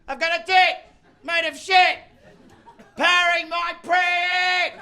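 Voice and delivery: high, loud yell, slowing on the end; gleeful yell. Retold or imitated. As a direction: bellow it with childish glee. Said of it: "glee from yelling".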